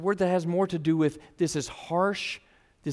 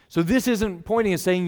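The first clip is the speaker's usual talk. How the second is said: ordinary delivery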